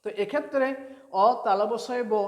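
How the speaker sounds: ordinary talk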